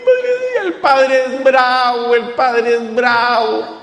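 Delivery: whiny high wail breaking into a slow, drawn-out mock sob; a hammed-up child's cry, stretched and slow. Scolded child mock crying to the priest, whiny slow wail. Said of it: "el niño se pone a llorar".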